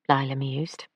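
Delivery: ordinary delivery, nothing marked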